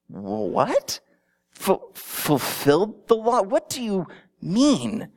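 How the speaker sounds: slow, lightly acted confused questioning; a listener caught off guard, mildly puzzled. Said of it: "some of the people in the audience going".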